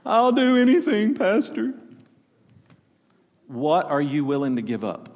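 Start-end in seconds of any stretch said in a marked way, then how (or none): 0.1-1.7 mocking whiny plea.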